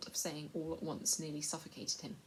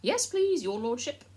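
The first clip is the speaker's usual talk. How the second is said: much higher, fawning plea; wheedling; 7 semitones higher, 11 dB louder than usual. A narrator's reading. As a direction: grovel and wheedle to the lord. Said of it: "in a wheedling kind of voice".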